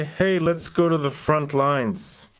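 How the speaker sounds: ordinary talk, nothing marked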